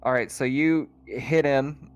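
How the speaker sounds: ordinary talk, nothing marked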